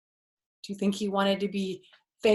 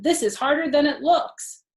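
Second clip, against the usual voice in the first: frustrated complaint; 7 semitones higher, 8 dB louder than usual. Acted. Fox after pain.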